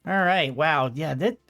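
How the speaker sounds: ordinary delivery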